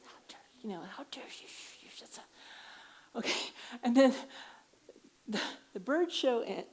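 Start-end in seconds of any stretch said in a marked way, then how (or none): 0.0-2.2 retold angry whisper. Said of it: "so I lost it".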